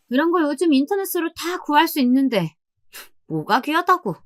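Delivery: high-pitched dismissive grumble; pooh-pooh it in a high voice; belittling sister-in-law. A narrator's reading. Sister-in-law sneers at the souvenir for mother, belittling.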